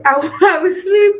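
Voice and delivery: loud, high, laughing declaration; real laugh bursting through the words. Real, not acted. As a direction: break into a laugh mid-phrase, voice lifted. Laughs declaring she will sleep.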